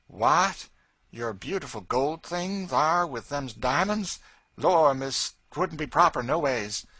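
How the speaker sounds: loud, startled nanny protesting; mammy voice, shocked and fond; old nanny refusing the girl's gift, loud and startled; old Southern nanny. A narrator's reading.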